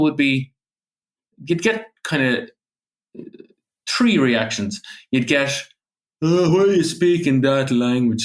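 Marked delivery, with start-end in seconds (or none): 6.2-8.2 lightly voiced scoffing pubgoer asking why that language; mock a scornful local who calls it dead; a hint of a sneering stranger in a pub; dismissive local. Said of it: "they'd be cursing at you".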